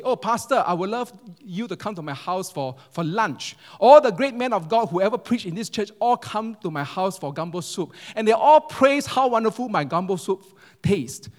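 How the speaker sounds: gushing invitation. Retold or imitated. Church lady inviting the pastor to lunch, gushing. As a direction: gush with pride about your soup.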